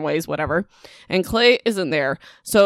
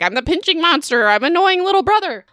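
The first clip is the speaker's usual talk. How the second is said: put-on much higher, taunting kid voice; bratty little brother; 10 semitones higher than usual. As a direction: tease like an annoying little brother. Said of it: "He's like".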